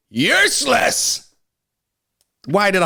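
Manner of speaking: high, slow, dragged-out yell; angry outburst. Real, not acted. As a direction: spit the word out in disgust. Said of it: "'Cause I'm angry".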